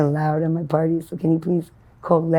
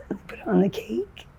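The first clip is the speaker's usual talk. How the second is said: whisper; 3 semitones higher, 7 dB quieter than usual. Real, not acted. Describing dessert, drops voice.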